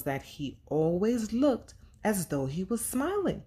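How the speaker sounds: animated picture-book narration; storybook reading. A narrator's reading. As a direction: read the story to young kids. Reads picture book aloud.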